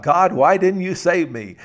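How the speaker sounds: high, loud complaint to God; hammed-up wail of protest; indignant protest. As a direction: demand an explanation, aggrieved. Drowned man protests to God in joke.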